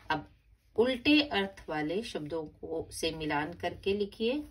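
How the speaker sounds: normal talking voice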